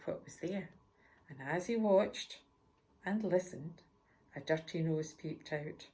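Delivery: ordinary delivery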